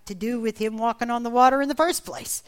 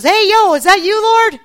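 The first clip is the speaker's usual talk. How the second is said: high, loud shout across the water; big, informal yelled hail; Peter calling out; 11 semitones higher, 13 dB louder than usual. Acted. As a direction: holler a startled question into the distance. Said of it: "And Peter goes".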